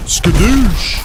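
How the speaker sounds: loud, high battle cry; Skadoosh shout. Pretend. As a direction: yell it like Kung Fu Panda. Catchphrase yelled.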